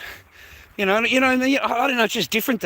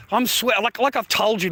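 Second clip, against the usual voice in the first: normal talking voice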